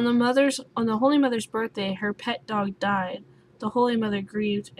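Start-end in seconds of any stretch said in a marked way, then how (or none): none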